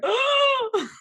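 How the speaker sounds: high, loud startled oh; caught out. Retold or imitated. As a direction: yelp as if caught red-handed. Caught cursing, gasps.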